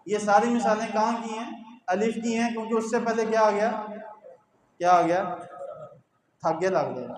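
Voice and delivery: ordinary delivery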